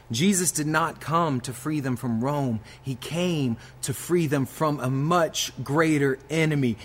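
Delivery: preaching at full force. Acted. Pastor building to the gospel climax, rising fervent preaching. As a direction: climb into a rousing sermon climax.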